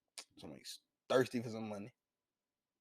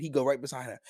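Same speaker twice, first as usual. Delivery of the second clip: normal talking voice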